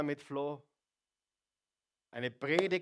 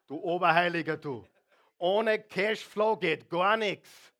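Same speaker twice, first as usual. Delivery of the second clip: teasing shout; 4 semitones higher, 9 dB louder than usual. Acted. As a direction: call out the holier-than-thou. Teasing the congregation loudly.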